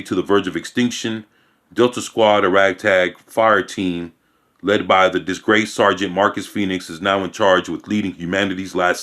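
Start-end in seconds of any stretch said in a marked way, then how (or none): none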